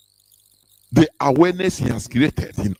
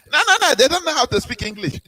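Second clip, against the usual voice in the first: high, fast, scornful mimicry; mock dismissive quote; 10 semitones higher, faster than usual. Mocking villagers who refuse English, high and fast. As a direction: rattle it off higher like a sneering local. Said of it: "And you'll be having them".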